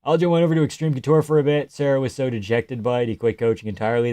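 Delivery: usual speaking voice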